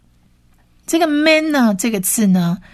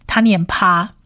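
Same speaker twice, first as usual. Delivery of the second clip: ordinary talk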